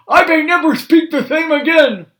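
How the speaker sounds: high, loud toothless moan; Grandpa moaning. Acted. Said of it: "moaned Grandpa".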